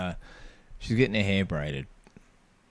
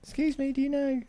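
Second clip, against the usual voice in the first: put-on high, small child's voice, polite; young Xavier's voice. Retelling his kid politely asking a stranger, high child voice. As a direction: pitch way up like a little kid asking an adult.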